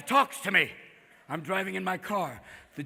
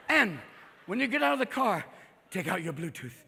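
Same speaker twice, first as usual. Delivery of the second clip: ordinary delivery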